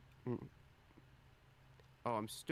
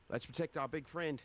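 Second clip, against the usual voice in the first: higher-pitched rallying call, mildly raised voice; light yell; 6 semitones higher, 4 dB louder than usual. Acted. Adventurer calls to allies to protect their big friend, lightly yelled. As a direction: call up to your allies without a full shout. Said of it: "I'll yell up".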